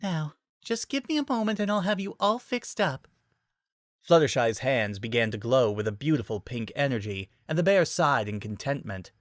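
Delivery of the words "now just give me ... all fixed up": put-on soft, high, gentle girl; gentle Fluttershy voice. A narrator's reading. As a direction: soothe a hurt animal softly. Fluttershy soothing hurt bear.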